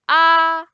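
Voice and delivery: "ah" loud, high and flat; sustained high level "ah"; first tone. Shown as a demonstration. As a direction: hold "ah" on one high level pitch. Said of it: "flat and high".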